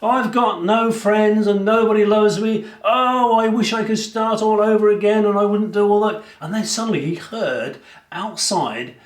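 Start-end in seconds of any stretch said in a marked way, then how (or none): 0.0-6.2 high, whiny lament of regret; self-pitying prisoner. Acted. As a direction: wail it out, feeling sorry for yourself. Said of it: "he was like".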